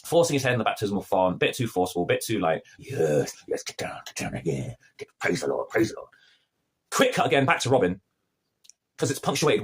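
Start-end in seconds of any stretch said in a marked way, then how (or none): 2.8-6.1 low, hushed urging; quiet and low, like a furtive priest. Acted. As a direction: press them down in a low, half-whispered voice. Acting priest forcing head under.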